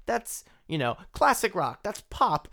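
high, excited, unsure back-and-forth; confused genre guessing. Retold or imitated. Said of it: "you're like".

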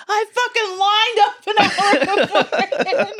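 high, slow, laughing mock indignation; imitating his outrage while laughing. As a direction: squeal it through a laugh.

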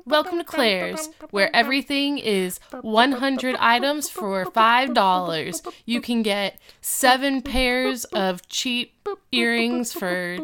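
punchy mock commercial announcer; hammed-up store advert; parody ad read. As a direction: sell bargains with drawn-out prices.

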